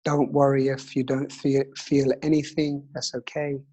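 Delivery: calm counting breath guide; slow soothing breathwork instructor; guided meditation. Acted.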